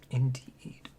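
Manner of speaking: breathy whispered aside. Real, not acted. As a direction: hush it, just air. GM aside during sea axe talk.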